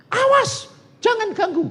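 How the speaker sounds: very high, loud cry; shouted warning. Retold or imitated. Man in the mob recognizes the doctor, high loud warning shout. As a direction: imitate a man yelling to halt a mob.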